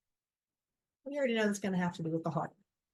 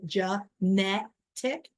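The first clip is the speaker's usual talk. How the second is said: "genetic" broken into syllables, NET hit hardest; slow "genetic" apart, punch the middle syllable; stress on second syllable; much slower than usual. Shown as a demonstration. Teacher showing students where emphasis falls, stress on middle of "genetic". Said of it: "the emphasis is on the second syllable".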